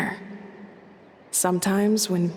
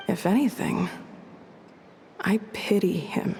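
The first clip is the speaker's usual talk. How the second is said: whispered pity; 3 dB quieter than usual. Acted. Confiding about enemy, voice drops.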